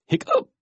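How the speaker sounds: very high, sharp hiccup; loud squeaky hiccup. Acted. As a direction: pop out a sudden high hiccup. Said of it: "every time he hiccuped".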